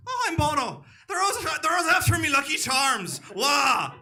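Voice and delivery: loud, high mock Irish shout; hammed-up Irish leprechaun voice. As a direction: yell it like a leprechaun ad.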